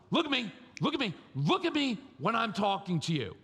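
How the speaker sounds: high, insistent dad demanding eye contact. Acted. Dad orders his child to look at him, demanding. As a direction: repeat it urgently as a scolding father. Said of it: "it's like, comes with the dad parenting thing".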